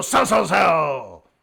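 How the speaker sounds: loud, drawn-out mock sell order; punched, slow and loud; trader's shout. Acting trader firing off orders.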